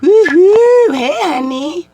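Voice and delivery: very high, loud hooting catcall; imitating a construction catcall, very high and loud; mock catcall. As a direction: holler it like a builder at a passer-by. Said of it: "they hoop and holler".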